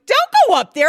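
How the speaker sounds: frantic shout at a TV character; yelling at the TV. Retold or imitated. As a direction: scream a warning at the TV. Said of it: "literally yelling at the T V".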